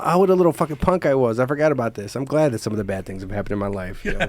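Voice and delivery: usual speaking voice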